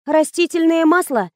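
high-pitched tentative blurt; неожиданно ляпнула. A narrator's reading. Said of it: "неожиданно ляпнула я". (внезапная реплика высоким голосом)

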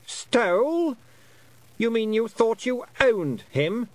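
high, loud, drawn-out queenly disbelief; incredulous Queen. A narrator's reading. Queen echoing the girl's accusation, high incredulous voice. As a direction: throw it back as an affronted queen.

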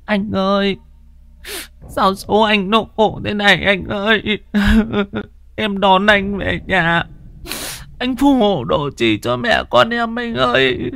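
high keening widow's lament; wailing lament. Acted. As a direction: wail to the dead husband. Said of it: "thì than vãn". (giọng than khóc ai oán rất cao)

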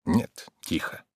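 barely voiced, very soft; whisper. A narrator's reading. Man waits breathlessly for her steps, whispering. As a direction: whisper it while listening.

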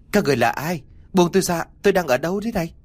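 high-pitched, mildly acted panic; restrained cry of alarm; frightened yelling. A narrator's reading. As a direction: demand release in fear, pitch climbing. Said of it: "cho tôi không ngừng la hét".

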